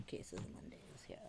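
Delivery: breathy whispered aside. Real, not acted. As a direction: whisper it to the listeners. Unvoiced aside.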